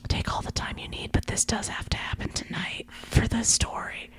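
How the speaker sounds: hushed, fully whispered line; imitating a secretive bedside whisper. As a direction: whisper it into someone's ear. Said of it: "whispers in John's ear".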